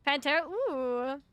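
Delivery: ordinary talk, nothing marked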